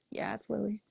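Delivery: soft, slow, shy answer on the phone; a timid murmur to a stranger. Retold or imitated. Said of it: "still talking super slow".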